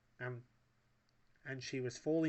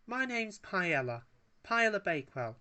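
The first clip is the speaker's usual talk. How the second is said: lady voice; 7 semitones higher, 5 dB louder than usual. A narrator's reading. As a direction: introduce herself grandly. Paella voiced by narrator.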